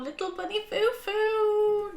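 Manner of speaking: high, drawn-out gleeful squeal; gleeful excitement. Real, not acted. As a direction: show off a treasured gift, stretching the words. Thrilled by gifted skein of yarn.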